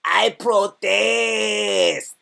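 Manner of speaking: very slow, drawn-out put-on voice; Bruce Lee film quote. Quoting the Bruce Lee film, slow drawn-out put-on voice. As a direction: stretch it out like the movie line. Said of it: "that reference where I go".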